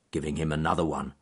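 ordinary delivery, nothing marked

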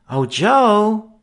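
high, loud call of his name. A narrator's reading. Sister calling to Joe from the house, high call. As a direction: sing his name out from another room.

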